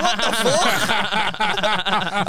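laughing disbelief. Retold or imitated. Shocked reaction in taxi story.

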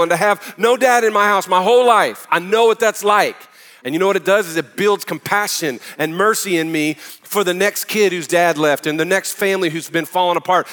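loud, driving preacher's voice; preaching. Acted. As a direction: preach it out with force. Preaching at full force.

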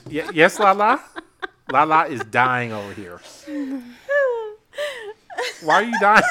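loud, high, laughing question; tickled and loud, voice riding up; amused. Real, not acted. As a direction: call it out through laughter. Teasing a friend dying laughing.

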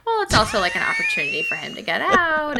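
high and loud through laughter; laughing loud. Real, not acted. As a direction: burst out laughing while answering.

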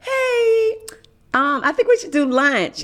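chirpy networking hello; real cheery. Retold or imitated. Messaging a new contact, real cheery and high. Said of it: "real cheery".

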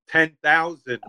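high-pitched but unshouted yell; mild yell. A narrator's reading. Shopkeeper demanding an absurd sum from customer, mild yell. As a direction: lift the pitch into a contained yell. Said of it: "he yelled".

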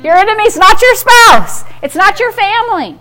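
ordinary talk, nothing marked

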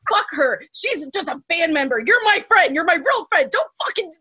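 high, imitated indignant friend; rising mock-angry protest; super pissed. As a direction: imitate a friend ranting in your defence. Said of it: "super pissed".

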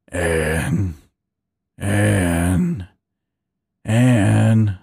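low draconic rumble, whispered; half dragon voice. Acted. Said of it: "Dan's voice whispered, cutting through her dreams with a draconic rumble".

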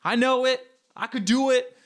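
high-pitched, cocksure boasting; cocky male ego. Acted. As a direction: puff it up with overconfident bravado. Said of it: "male ego that creeps in where you go".

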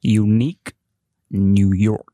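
Unique New York crisply enunciated warm-up; each syllable of Unique New York exaggerated; prim precise elocution. Shown as a demonstration. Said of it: "we need to warm up again".

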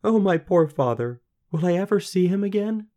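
high, loud plaintive wail; downhearted girl. A narrator's reading. Daughter missing her lonely father, downhearted high wail. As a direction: cry out for her father, pitch climbing. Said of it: "more downhearted than ever".

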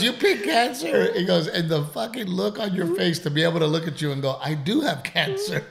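high, loud laughing. Retold or imitated. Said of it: "busted out laughing".

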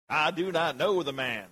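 mock Southern drawl on Peter's denial. Preacher joking about Peter's accent, Southern drawl. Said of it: "southern drawl".

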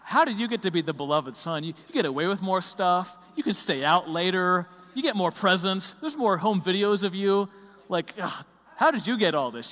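hammed-up jealous sibling gripe; mock sibling complaint. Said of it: "my brother and sister will often be like".

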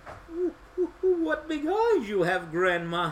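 put-on high, stuttering little girl; nervous stammer. A narrator's reading. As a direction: stumble on the first word, girlishly. Nervous girl before disguised wolf.

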